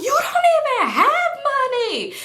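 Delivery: very high, exasperated mock yell; hammed-up yelling. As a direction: act out shrieking a comeback. Acted argument, throwing it back.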